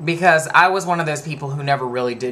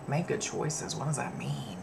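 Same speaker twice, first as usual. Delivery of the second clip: softer whispered aside; puzzled whisper; 13 dB quieter than usual. Retold or imitated. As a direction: mutter it, baffled. People puzzled by the saying.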